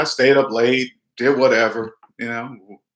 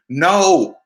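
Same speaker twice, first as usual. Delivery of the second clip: a flat, final NO, louder and higher; firm no; 4 semitones higher, 4 dB louder than usual. Shown as a demonstration. Refusing a relative's loan request, firm and final. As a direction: shut it down with one hard NO. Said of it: "You say it like that".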